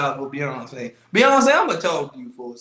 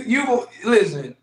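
normal talking voice

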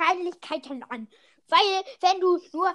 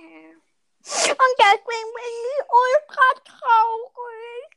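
slow, louder, whining mock sorrow; mock sad whine; 6 semitones higher, 8 dB louder, much slower than usual. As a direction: wail it slowly, hammed-up sad. Mock sad, loud and drawn.

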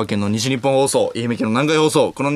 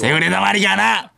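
put-on very high, crude child-star caricature; 芦田愛菜; 5 semitones higher than usual. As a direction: squeak it out as a fake little girl. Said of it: "芦田愛菜ちゃん、". (芦田愛菜のものまねのものまね、甲高く)

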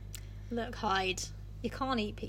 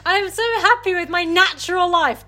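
higher, louder sing-song glee; mock happy Jekyll; 6 semitones higher, 9 dB louder than usual. Friend mocking Jekyll's contentment, loud and chirpy. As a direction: chirp it like a smug do-gooder. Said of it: "voicing Jekyll".

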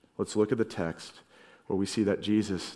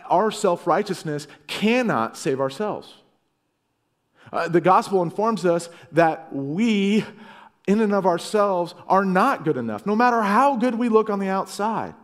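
rising preacher's fervour, louder and higher; preaching; 9 semitones higher, 5 dB louder than usual. Acted. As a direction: build the sermon to a peak. Pastor preaching at full force.

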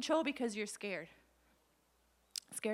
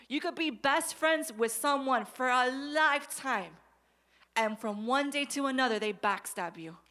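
preaching at full force; 2 semitones higher, 5 dB louder than usual. Acted. Pastor preaching to the congregation at full volume. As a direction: drive it home to the church.

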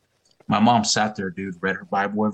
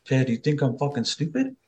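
imitated angry wife, higher voice; accusing question pitched up at the end; angry confrontation; 6 semitones higher than usual. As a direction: act out a wife confronting her husband. Mother confronting cheating father.

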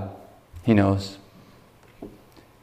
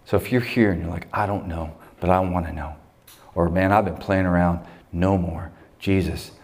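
leading prayer; 4 semitones lower than usual. Acted. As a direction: guide the room into prayer, gently. Leads the closing prayer.

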